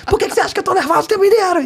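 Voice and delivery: fast and high, like sped-up audio; double speed audio. Retold or imitated. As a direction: rush it out like a voice note at double speed. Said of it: "E em dois x".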